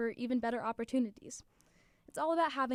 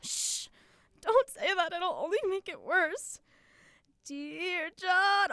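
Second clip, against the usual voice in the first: high, loud mock hush; imitating a friend hushing the group; 7 semitones higher, 5 dB louder, slower than usual. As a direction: play a friend shushing the others in alarm. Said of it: "it sounded something like".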